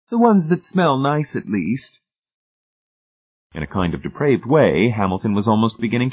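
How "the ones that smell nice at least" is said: high, prim self-righteousness falling at the end; sanctimonious. A narrator's reading. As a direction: justify it piously in a high lady's voice. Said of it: "Missus Pritchett said sanctimoniously".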